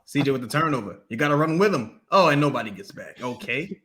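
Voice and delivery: low, resigned play-by-play; Joel Myers impression. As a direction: imitate a fed-up announcer calling turnovers. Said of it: "He's like this".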